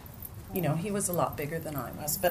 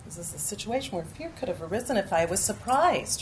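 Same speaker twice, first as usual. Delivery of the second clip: ordinary talk, nothing marked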